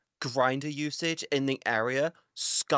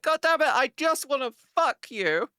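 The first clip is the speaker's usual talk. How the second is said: mock frustrated Republican; 11 semitones higher, 3 dB louder than usual. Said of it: "being like".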